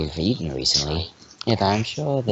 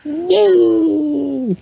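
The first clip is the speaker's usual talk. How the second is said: high excited yay; 5 dB louder than usual. Acted. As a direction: squeal of delight, high and loud. Cheerful character cheer.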